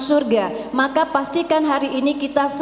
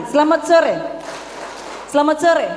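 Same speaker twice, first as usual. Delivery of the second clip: rally the crowd with the greeting; loud high call; 3 semitones higher than usual. Shown as a demonstration. Speaker to parents, clap cue called out loudly. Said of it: "selamat sore tiga kali".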